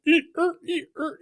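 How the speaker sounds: high squeaky crank-window noise; squeaking window, high and creaky. Retold or imitated. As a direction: mimic a rolling window creaking open. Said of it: "I hear his window go".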